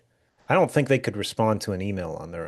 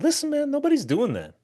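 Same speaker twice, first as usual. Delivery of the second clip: high mock dismissal. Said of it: "I'm like".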